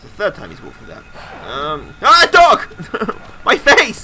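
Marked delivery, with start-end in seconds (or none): 1.5-3.2 startled high shout; scared horror game streamer. Real, not acted. Dog attacks in horror game. 3.4-4.0 high shriek through laughter; laughing scream. Real, not acted. Dog leaps at face.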